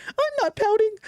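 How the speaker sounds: very high, whiny imitation; mock pouty denial. Host mimicking friend who denies sulking, mock pout. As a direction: imitate a sulker denying it. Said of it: "He said, like, literally, like".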